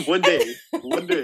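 laughing amazement. Real, not acted. Friend laughing over the chaos of one shift. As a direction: marvel at it through laughter.

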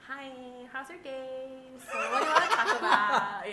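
high, exaggerated sing-song sweetness; fake smiling greeting. Host opens meeting with late client, falsely sweet. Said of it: "I'll be, like, smiling again".

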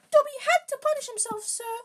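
very high, loud squeaky elf; Dobby. A narrator's reading. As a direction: squeak excitedly in a high falsetto. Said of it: "said the elf".

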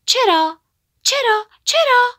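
rising, very high, loud challenge; a bird jumps forward and challenges the bully, loud and high; defiant demand. A narrator's reading. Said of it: "یکی جلو پرید و پرسید".

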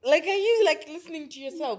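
mock-shouting at her in the club; retold incredulous outburst. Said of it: "I was like".

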